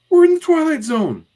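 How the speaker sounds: very high, loud announcement; hammed-up kid clowning; teasing kid. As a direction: proclaim it in a squeaky mock-spooky voice. Jack teases after theme.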